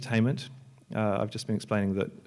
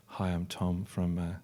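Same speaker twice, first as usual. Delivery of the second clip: droning presenter; 4 semitones lower, 6 dB quieter than usual. Retold or imitated. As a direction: lightly mimic a dull talker reading facts. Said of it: "he's going on about some information".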